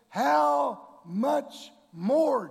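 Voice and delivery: slow, loud and high; preaching shout. Acted. Preacher driving the verse home to the church, slow shout. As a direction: stretch each word out in a big shout.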